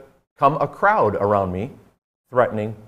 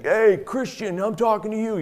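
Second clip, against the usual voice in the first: mock yell; 9 semitones higher than usual. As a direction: imitate an agitator calling out, pitch raised. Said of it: "he starts yelling to me".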